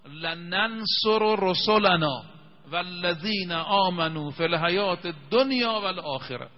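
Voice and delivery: solemn reciter's chant; Quranic recitation. Shown as a demonstration. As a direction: chant each word drawn out. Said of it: "خدای تبارک و تعالی قول داده".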